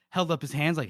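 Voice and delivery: ordinary talk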